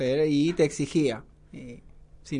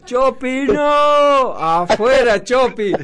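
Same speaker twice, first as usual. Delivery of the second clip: higher, louder mock yell; retold yell; 5 semitones higher, 5 dB louder, slower than usual. Coach warning Chopi before the tackle, imitated yell. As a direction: call out to stop him, a half-hammed warning. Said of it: "Carlos gritaba".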